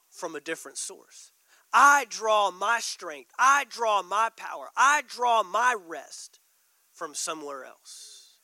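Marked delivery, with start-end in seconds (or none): 1.7-6.4 slow, louder, every I planted; emphatic slow declaration. Real, not acted. Preacher declaring to the congregation, slow and strong. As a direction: declare it slowly with force. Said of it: "preaching declaration".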